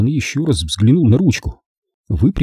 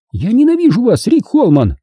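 high-pitched, outraged declaration; indignant; 13 semitones higher, 4 dB louder than usual. A narrator's reading. Woman turns on the man who changed plans, indignant. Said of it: "заговорила Лайза с возмущением".

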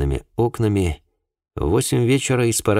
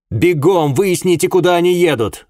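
loud, high urgent order; raised, hurried-sounding command; called over the shoulder; 11 semitones higher, 5 dB louder than usual. A narrator's reading. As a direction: shout a command while rushing off. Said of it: "бросил я через плечо".

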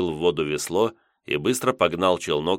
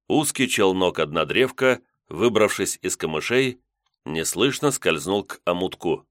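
usual speaking voice

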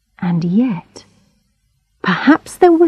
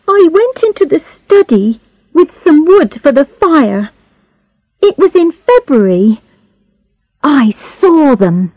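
put-on girl's higher-pitched voice, telling what she saw; upset Charlotte, recounting; 7 semitones higher than usual.

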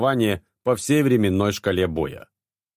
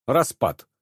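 curt command; 4 semitones higher than usual. A narrator's reading. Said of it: "коротко бросил Реджинальд".

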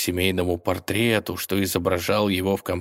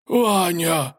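high loud call; yelling a name; 10 semitones higher, 4 dB louder than usual. Acted. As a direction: shout his name in alarm. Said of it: "заорал Волк". (заорал)

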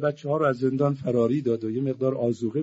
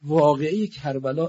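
ordinary talk, nothing marked

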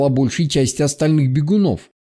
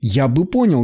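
usual speaking voice

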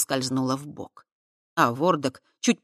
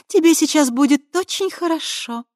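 femme fatale; 10 semitones higher, 7 dB louder than usual. A narrator's reading. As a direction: lure him in, sweetly. Krista seducing.

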